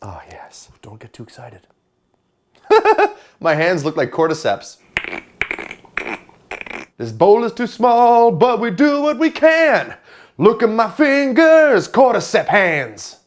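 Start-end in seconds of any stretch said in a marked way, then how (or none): 0.0-1.7 soft whispered aside. Pretend. 7.0-13.2 loud slow hammy voice. Pretend. Loud slow exaggerated complaint.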